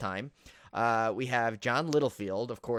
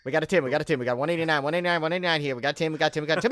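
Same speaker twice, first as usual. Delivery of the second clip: auctioneer; 4 semitones higher, 6 dB louder, much faster than usual. Pretend. Mock auctioneer chant.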